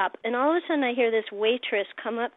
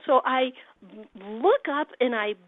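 ordinary delivery

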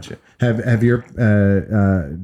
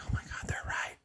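hushed, retold self-doubt; imitated inner panic, very soft; whispered realization; 18 dB quieter than usual. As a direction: whisper it as a private worry. Retold private worried realization.

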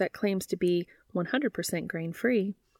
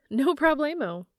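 perky clerk; 8 semitones higher than usual. Acted.

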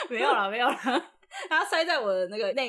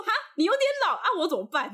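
auntie's quote; 7 semitones higher, faster than usual. Retold or imitated. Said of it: "他说".

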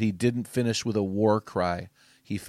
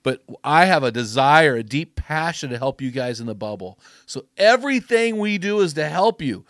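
louder, higher, rousing sermon voice; fired-up preaching; 6 semitones higher, 7 dB louder than usual. Acted. Podcast host rallying men to finish strong, fired-up preaching.

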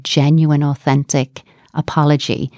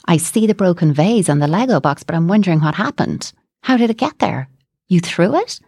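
curious parent; 5 semitones higher than usual. Shown as a demonstration. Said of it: "Something like that".

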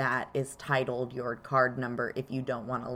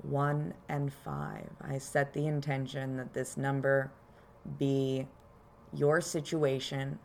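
calm grounding exercise; 4 dB quieter than usual. Acted. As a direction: guide them gently into their body. Leads a grounding meditation.

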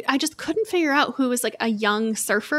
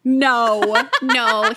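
laughing; 5 dB louder than usual. Real, not acted. Laughs at Vince Vaughn suggestion.

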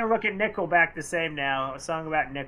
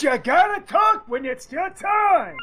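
playful shout; 13 semitones higher than usual. Real, not acted. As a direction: shout at the silent caller. Co-host yelling at silent caller.

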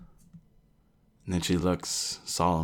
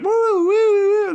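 ship alarm; 10 dB louder than usual. Retold or imitated. Ship's alarm blaring as the machine overloads, siren wail. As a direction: imitate the ship's alarm going off. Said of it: "The ship's like".

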